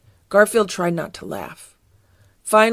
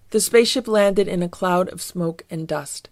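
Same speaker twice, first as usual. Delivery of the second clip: ordinary delivery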